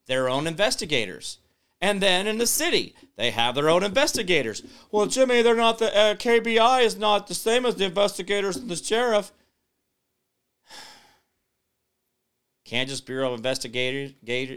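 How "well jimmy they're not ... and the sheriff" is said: high, loud nitpicking voice; mock a viewer who corrects him; mock know-it-all viewer. Imitating a viewer nitpicking about investigators, high whiny know-it-all.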